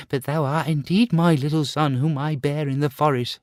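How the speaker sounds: high, pleading mother's voice. Acted. Mother kneeling before her son, high and pleading. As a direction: beg him to know you as her son. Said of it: "she cried".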